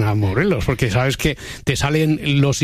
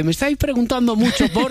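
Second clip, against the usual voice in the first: mock influencer; 8 semitones higher than usual. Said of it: "cuando un influencer dice".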